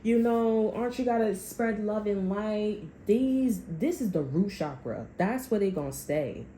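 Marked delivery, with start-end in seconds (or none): 0.0-5.1 higher, sing-song mockery of the love-and-light types; mock preachy. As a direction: parody them sweetly and a little slow. Mocking the love-and-light crowd.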